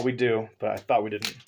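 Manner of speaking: usual speaking voice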